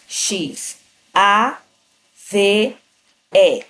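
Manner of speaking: X, A, V, E said separately with pauses; sounding out. Shown as a demonstration. Teacher sounding out chave piece by piece. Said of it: "É como se eu estivesse escutando".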